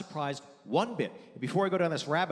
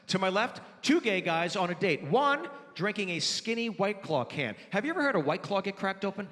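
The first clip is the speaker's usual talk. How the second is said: ordinary delivery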